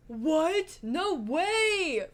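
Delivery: loud, high, drawn-out mock amazement; hammed-up disbelief, stretching the WAY; all-caps astonishment. Friend texting after a close trolley catch, loud all-caps astonishment. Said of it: "Four Ys, all capitals".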